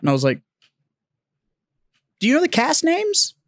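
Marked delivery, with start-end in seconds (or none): 2.2-3.3 high-pitched, feigned-innocent question; coy probing question. Retold or imitated. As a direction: ask it high, fishing for recognition. Retells own coy probing question.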